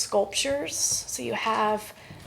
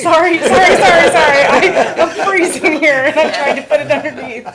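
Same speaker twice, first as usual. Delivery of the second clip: flustered laughing; 6 semitones higher, 16 dB louder than usual. Real, not acted. Flustered mic mishap.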